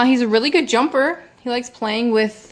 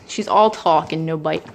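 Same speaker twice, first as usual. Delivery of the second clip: normal talking voice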